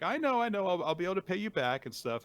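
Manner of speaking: high-pitched put-on placating voice; imitating someone wriggling out of a debt; hasty brush-off. Acts character brushing off debt collector.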